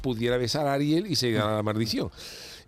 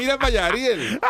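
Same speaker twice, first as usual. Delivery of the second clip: eager urging; 7 semitones higher than usual. Acted. Urging Ariel to look.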